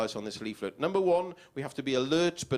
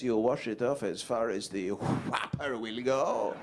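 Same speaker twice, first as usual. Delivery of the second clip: slow, sly car voice; lightly voiced car; 4 semitones lower, slower than usual. Car mocks owner's windscreen washing, slow and sly. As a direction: drag it out teasingly.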